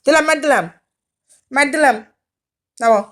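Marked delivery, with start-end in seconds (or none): 0.0-2.0 loud pretend threat to smack; mock threat. To the stubborn pet on the bed, a loud playful threat. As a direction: threaten loudly but only in play. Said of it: "মার দেওয়ার কথা বলে".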